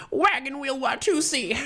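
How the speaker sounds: hammed-up celebrity impersonation. Shown as a demonstration. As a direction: belt it out as a high caricature. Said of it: "my beautiful [name] impersonation".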